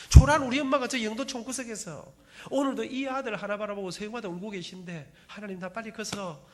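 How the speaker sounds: retold crying prayer, loudest on the mother line; tearful pleading prayer. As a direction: beg God with a swelling voice. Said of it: "울면서 하나님 앞에 기도 많이 했습니다".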